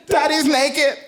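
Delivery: put-on high child's voice, upset; high, distressed little-girl report; 1 shocked little girl. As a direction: wail it like a horrified toddler.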